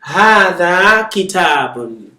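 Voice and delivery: Hadha kitabun drawn out slowly; slow model sentence. Shown as a demonstration. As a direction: say Hadha kitabun for them to repeat. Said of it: "So you say".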